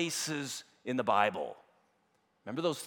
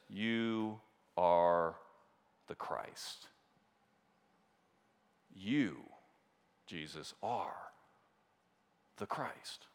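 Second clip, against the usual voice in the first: soft, hushed Peter, nearly breathless; hushed confession; 7 semitones lower, 8 dB quieter, much slower than usual. Acted. Preacher voicing Peter's awed confession, hushed and soft. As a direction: let the words fall to a near hush.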